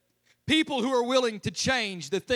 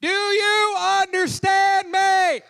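slow, loud, high shout at a foreigner; over-enunciate it like they're deaf; exaggerated loud shouting to a non-English speaker; mock shout; 10 semitones higher, 8 dB louder, much slower than usual. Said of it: "You ain't gotta shout it".

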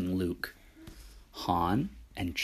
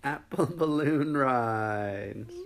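laughing through the words; slow, amused repeat; 4 semitones higher, slower than usual. Real, not acted.

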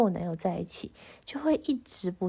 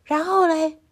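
put-on boyfriend's impatient question; 7 semitones higher, 6 dB louder than usual. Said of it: "然后我男友就说".

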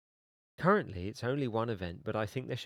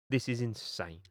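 usual speaking voice

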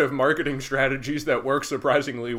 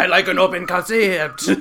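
louder, higher comic French voice; hammed-up French accent through laughter; mock French accent; 5 semitones higher, 6 dB louder than usual. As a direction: play a silly Frenchman. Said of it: "enough with the French".

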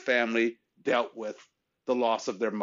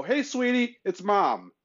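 much higher, a loving mom on voicemail; mom's voicemail greeting; 10 semitones higher, slower than usual. Retold or imitated. Her late mother's message on the voicemail, high and loving. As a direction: sing it out like a mother calling her child. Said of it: "And she would go".

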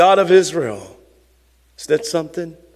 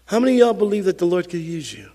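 normal talking voice

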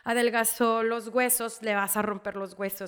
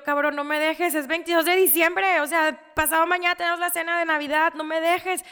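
loud, higher-pitched retold plea; desperate pleading; 6 semitones higher, 8 dB louder than usual. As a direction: beg him to stay, urgent and loud. Retold plea to dying husband. (súplica desesperada a gritos)

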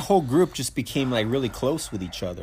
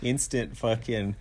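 ordinary talk

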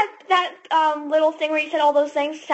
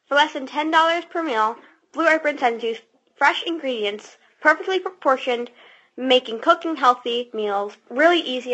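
ad read; 4 semitones lower than usual. Acted. Reads ad copy.

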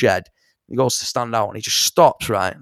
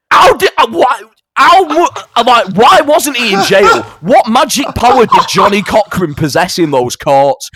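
very high and loud disbelief; high loud outraged rant; incredulous; 10 semitones higher, 8 dB louder than usual. Real, not acted. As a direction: shout your disbelief. Incredulous rant about the documentary.

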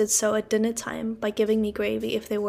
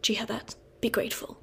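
softer whispered rebuke; low reproachful whisper; 7 dB quieter than usual. Acted. Sister scolding the boy at table in a low whisper. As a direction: hiss it under your breath as a reproach. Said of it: "in a low, reproachful voice".

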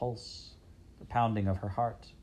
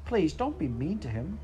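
pleading; 7 semitones higher than usual. Acted. Princess pleading.

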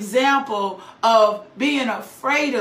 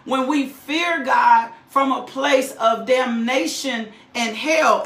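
normal talking voice

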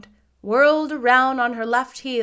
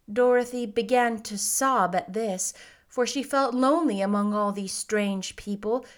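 ordinary delivery

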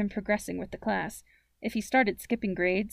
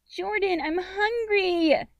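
put-on high, drawn-out child's whine; slow, high-pitched little-sister whining; most whiny voice; 11 semitones higher, 6 dB louder, much slower than usual. A narrator's reading. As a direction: whine at big brother for food. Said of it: "in her most whiny voice".

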